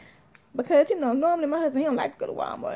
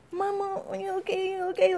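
small, high, soft worried voice; timid little check on Mama; imitating scared child; 4 semitones higher, 8 dB quieter than usual. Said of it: "she was scared".

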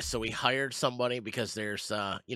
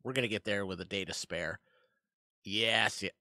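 ordinary delivery

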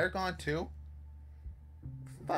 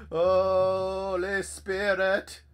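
loud, very slow, raised holy chant; drawn-out invocation; 4 semitones higher, 7 dB louder, much slower than usual. Pretend. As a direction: stretch HOLY SPIRIT out to banish a demon. Mock exorcism, first take.

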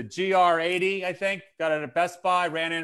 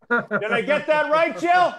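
high call, louder on the name; imitated catchphrase; 8 semitones higher, 5 dB louder than usual. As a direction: call out to Jill, pitch climbing. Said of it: "I'm gonna steal that from him".

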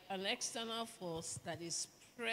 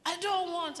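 higher, louder, mock sulky refusal; mock husband refusal; 11 semitones higher, 7 dB louder than usual. Wife mimicking husband rejecting food, mock sulky. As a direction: play the husband pushing the food away. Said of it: "I say".

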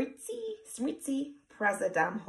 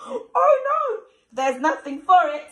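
high, loud, panicked gasp; hammed-up panic; 9 semitones higher, 9 dB louder than usual. Panic at ogre.

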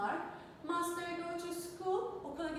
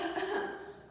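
a short, dry cough to show the word; demo cough; 5 semitones higher than usual. Shown as a demonstration. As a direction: cough once to act out cough. (kelimeyi göstermek için öksürük taklidi)

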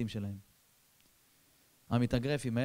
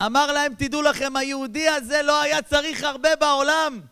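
much higher, louder impassioned rabbi; the rabbi's fiery eulogy; 13 semitones higher, 11 dB louder than usual. Retold or imitated. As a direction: thunder out the eulogy. (הרב מספיד בקול רם וגבוה)

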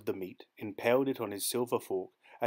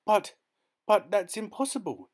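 much higher and louder, tripping on but; stammering; 11 semitones higher, 6 dB louder than usual. A narrator's reading. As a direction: stutter in shocked disbelief. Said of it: "the youth stammered".

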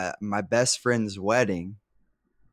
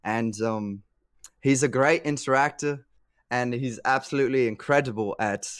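mock British commentator accent; cheesy British accent; 2 semitones higher than usual. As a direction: play a cheeky Brit, mostly half-hearted. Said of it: "I forgot about my British accent".

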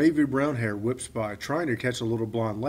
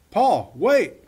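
loud, higher call after someone leaving; shout; 8 semitones higher, 7 dB louder than usual. Acted. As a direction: shout after him as he walks off. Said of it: "I shout after him".

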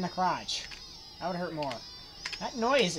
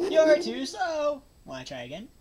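cocky Sonic voice; 10 semitones higher than usual. Acted. Voicing Sonic character.

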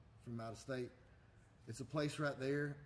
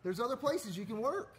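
loud sharp voice; 9 semitones higher, 4 dB louder than usual. Real, not acted. Mock rant against McDonald's.

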